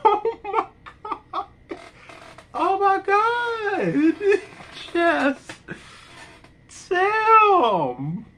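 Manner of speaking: real laughter, high squeaky voice; laughing hard. Real, not acted. Fan cracking up over a parody death scene, laughing hard. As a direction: gasp out stretched, high exclamations through helpless laughter. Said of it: "I'm laughing so much".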